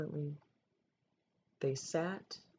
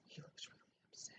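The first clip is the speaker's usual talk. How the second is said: breathy whispered aside; 22 dB quieter, faster than usual. Real, not acted. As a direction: lean in and whisper to the listener. Aside to listener about the story.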